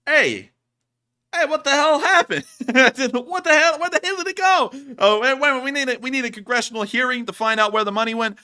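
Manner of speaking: high, loud indignant yelling; mock outrage. Streamer demanding where the CIA money went, mock outrage. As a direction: explode in playful disbelief, laughing between outbursts.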